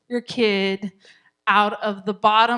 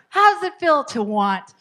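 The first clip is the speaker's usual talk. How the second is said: louder, higher, failed empathy; unempathetic attempt; 5 semitones higher, 6 dB louder than usual. Shown as a demonstration. Coach fails to say the line empathetically, loud and pointed. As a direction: say it pointedly, without sympathy. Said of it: "I don't know if I could say that empathetic".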